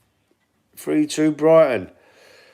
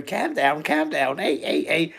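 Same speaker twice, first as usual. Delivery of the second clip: put-on softer, comic Scouse accent; Harry Enfield Scouse impression; 3 semitones higher, 5 dB quieter, slower than usual. Streamer showing the only Scouse he can do, comic Scouse impression. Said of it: "The Harry Enfield thing, that's all I can do".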